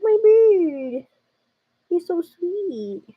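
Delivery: high, drawn-out adoring coo; gushing. Retold or imitated. Girlfriend touched by her partner's words, gushing. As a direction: gush over a sweetheart, stretching the vowels.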